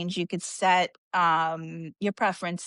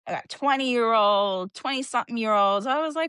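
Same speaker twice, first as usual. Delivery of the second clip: ordinary talk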